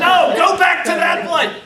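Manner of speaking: high, loud barked command; furious mentor yelling at rookie; angry order. Retold or imitated. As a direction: shout him back to work. Mentor shouting at him.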